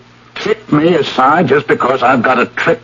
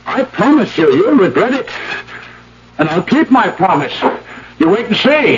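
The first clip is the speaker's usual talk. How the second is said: rising fury; 5 semitones higher than usual. Acted. Furious at broken promise.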